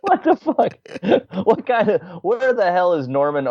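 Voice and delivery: can't believe what he just heard; incredulous. Real, not acted. Incredulous about Bob Evans.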